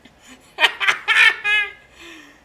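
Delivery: squealing high-pitched laughter; high laughing fit. Real, not acted. Continued laughter.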